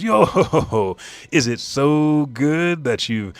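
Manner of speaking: high, loud laughing character voice; slow, jolly amazed question after a chanted laugh; Brooke. A narrator's reading. Acting Brooke laughing and asking.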